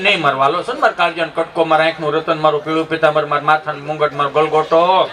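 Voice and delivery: low, crooning string of endearments; mock-tender mother hushing her child; soothing mother. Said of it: "મા સાને રાખતો હોય".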